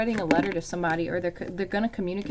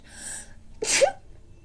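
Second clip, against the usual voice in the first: airy laugh after a sneeze; short whispery laugh; breathless laugh. Real, not acted.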